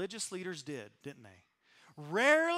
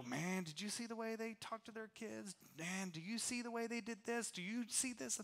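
hushed, judgmental gossip; gossipy murmur; 11 dB quieter than usual. Retold or imitated. Judging a neighbor in a hushed gossipy murmur. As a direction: lean in and tut about them under your breath. Churchgoers gossiping about others' failings.

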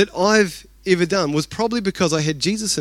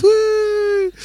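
cheer. Real, not acted. Celebrating new iMacs.

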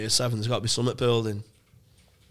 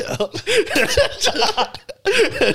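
high burst of laughter; 5 dB louder than usual. Real, not acted. Laughing at the story.

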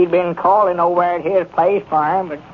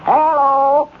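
higher, drawn-out telephone hello; raised, held-out hello; calling down the line; 8 semitones higher, 4 dB louder than usual. Acted. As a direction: hail everyone on the party line. Opens announcement on the party line.

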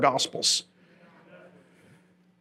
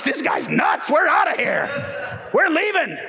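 crowd walking out; 7 semitones higher, slower than usual. Retold or imitated. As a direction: sneer it and storm off. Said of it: "Oh my gosh".

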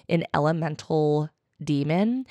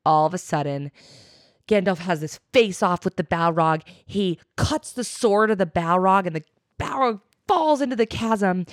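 usual speaking voice

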